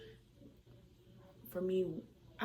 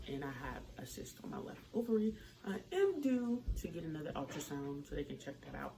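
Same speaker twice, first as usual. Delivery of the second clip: usual speaking voice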